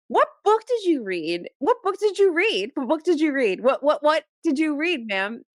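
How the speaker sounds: high-pitched, incredulous demand, repeated insistently; indignant disbelief. Acted. Reader confronting an academic over her wild claim, exasperated. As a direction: challenge the author as if utterly baffled.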